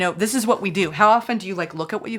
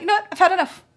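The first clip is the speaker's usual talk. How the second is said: fed up; 12 semitones higher, 4 dB louder, faster than usual. Retold or imitated. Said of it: "You're like".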